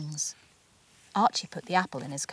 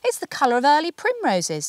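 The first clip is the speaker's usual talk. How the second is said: daddy marvelling; 7 semitones higher, 4 dB louder than usual. A narrator's reading. Daddy voiced in story.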